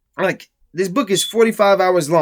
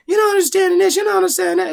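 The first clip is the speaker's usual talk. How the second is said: much higher whiny mock voice; mocking know-it-all; 13 semitones higher, faster than usual. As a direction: parody a smug fan correcting you. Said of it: "someone's gonna say".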